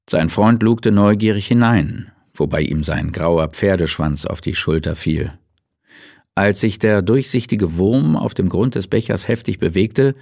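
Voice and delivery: ordinary delivery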